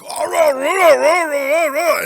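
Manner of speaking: hammed-up war cry, like throwing an axe; mock barbarian yell. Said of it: "let the barbarian out where I wanna throw an axe at you".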